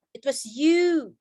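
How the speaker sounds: "you" drawn out long and slow; long U sound. Shown as a demonstration. Teacher showing students how it goes, long slow "you". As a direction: stretch the vowel of "you". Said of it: "Seperti itu".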